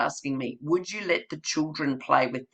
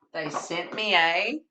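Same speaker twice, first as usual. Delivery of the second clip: lively read-aloud for little ones; reading to children. Acted. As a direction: tell it like storytime with small kids. Reading Dear Zoo to children.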